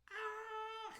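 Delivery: high, loud comic scream; short hammed-up shriek; mock scream. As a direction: scream in mock despair at the nonsense. Mock despair at nonsensical translation.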